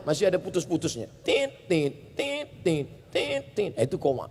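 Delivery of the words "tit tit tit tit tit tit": short broken beeping sounds; imitated monitor beeps. Shown as a demonstration. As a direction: beep like a hospital monitor. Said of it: "Koma itu bunyinya belum panjang". (bunyi tit tit monitor rumah sakit yang putus-putus)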